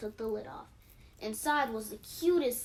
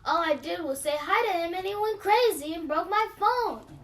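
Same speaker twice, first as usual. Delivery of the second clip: raised voice, defending herself; girl explaining to mom; upset pleading; 5 semitones higher, 6 dB louder than usual. A narrator's reading. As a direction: plead your case, close to tears. Lily pleading her innocence.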